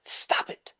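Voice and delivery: whispered retort imitating wife; hushed mock scolding. Wife's exasperated reply, retold.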